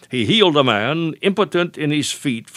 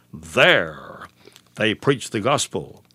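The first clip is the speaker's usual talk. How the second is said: low, isolated "There", then a pause; stress on THERE; 3 semitones lower, slower than usual. Shown as a demonstration. Preacher asking why scripture stresses this, stress on "There". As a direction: set "There" apart, low and drawn out, before continuing. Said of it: "So why the stress at this point?".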